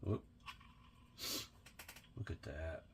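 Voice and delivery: usual speaking voice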